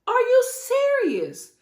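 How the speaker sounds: incredulous scolding. Acted. Speaker scolding Sutton over her dress, incredulous. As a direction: split it with a pause, utterly incredulous.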